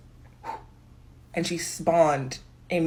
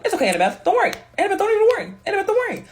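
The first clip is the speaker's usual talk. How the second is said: much higher, sugary brave reassurance; mock sweet Percy; 13 semitones higher, 4 dB louder, much faster than usual. Percy on the throne soothing Annabeth, mock high and sugary. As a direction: squeak it like a doomed hero comforting her. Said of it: "he was just being like".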